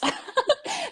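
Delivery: a burst of high laughter; real amused laugh after her story. Real, not acted. Laughing at being pushed.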